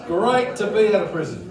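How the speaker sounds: ordinary delivery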